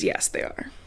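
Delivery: breathy secret reply, barely voiced; whispered answer. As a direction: whisper it into her ear as you hug. Whispered reply during the hug.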